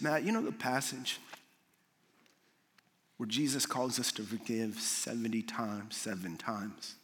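a mentor calmly steadying a friend. Retold or imitated. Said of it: "And very calmly".

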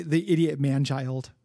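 ordinary delivery

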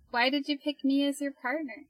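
ordinary talk

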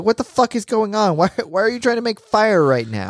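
high-pitched mock panic; freaking out. Retelling Inosuke baffled by sparks for good luck, high mock freak-out. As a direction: pitch it up, alarmed and demanding. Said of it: "like, freaking out".

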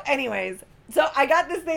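ordinary delivery

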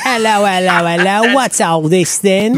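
loud, high mock British accent; hammed-up British, drawing out the last words. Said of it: "speaking in, with his British accent".

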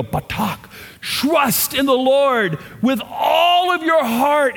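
high, drawn-out, impassioned cry of faith; emotional reading. Shown as a demonstration. Preacher showing how Hebrews would read it, with emotion. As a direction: stretch it out high with full emotion. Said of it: "It's an emotional word".

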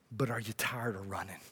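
very soft, low question; hushed appeal. Real, not acted. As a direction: gently confide the question, almost whispering. Preacher's intimate question to congregation.

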